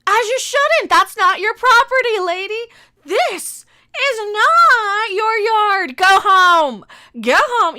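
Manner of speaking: loud, high telling-off; mock-confrontational yell at a nosy neighbor; scolding shout. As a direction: order a trespasser off the lawn. Telling off the woman on video.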